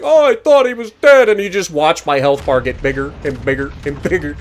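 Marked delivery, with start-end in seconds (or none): 0.0-1.3 high, louder whiny wail in imitation; hammed-up tearful complaint; mock crying. Gamer mimics the nearly beaten opponent watching him heal, mock crying. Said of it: "this Dalian's probably crying".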